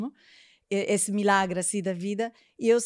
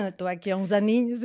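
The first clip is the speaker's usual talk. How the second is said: laughing; 7 dB louder, faster than usual. Real, not acted. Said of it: "estava eu com o sotaque português".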